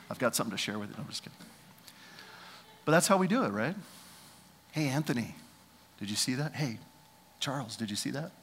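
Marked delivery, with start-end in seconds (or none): 4.7-8.3 soft conspiratorial gossip; hushed churchy gossip; gossiping whisper. Retold or imitated. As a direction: lean in and gossip. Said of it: "voicing church gossip".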